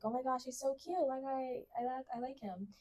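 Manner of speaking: mock lovestruck whisper-gush; swooning crush talk. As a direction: gush about him under your breath. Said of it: "I'm like".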